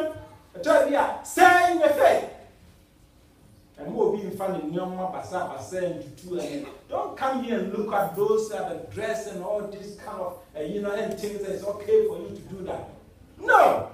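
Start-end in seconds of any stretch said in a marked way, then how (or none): 0.6-2.3 preaching shout. Real, not acted. Preacher exhorting the congregation. 13.4-13.9 high, loud "No!"; emphatic shout. Real, not acted. Preacher rejecting an excuse.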